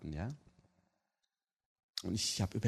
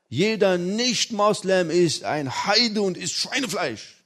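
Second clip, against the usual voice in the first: higher, louder, slow indignation; father insisting to his son, loud and indignant; 8 semitones higher, 9 dB louder, slower than usual. Retold or imitated. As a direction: declare it like an outraged father.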